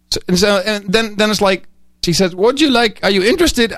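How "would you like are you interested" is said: imitating an Indian call-centre agent, higher voice; mock Indian accent, pitched up. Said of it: "I'm sorry to do the accent".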